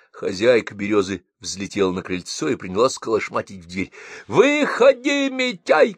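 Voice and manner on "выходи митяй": much higher, slow loud yell; landlady hollering at Mityay's door. Acted. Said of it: "колотилась она".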